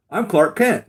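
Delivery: high, loud goofy Clark voice; mock Clark Kent. Villain demonstrating glasses on, high goofy Clark. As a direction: pitch it up like a meek nerd. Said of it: "He goes".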